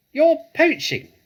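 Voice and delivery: very high, loud shriek; shrieking rat. A narrator's reading. Rat shrieks at the fox to go away. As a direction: screech it like a furious rat. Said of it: "shrieked Rat".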